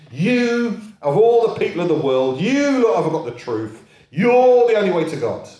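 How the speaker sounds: hammed-up arrogance, talking down to the world; mock arrogant. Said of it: "Christians sort of arrogantly saying".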